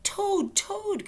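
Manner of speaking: very high, loud cry of Toad. Acted. Frog cries to sleeping Toad, high loud cry. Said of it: "cried Frog".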